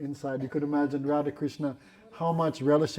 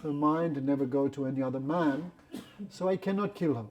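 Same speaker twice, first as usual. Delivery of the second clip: ordinary delivery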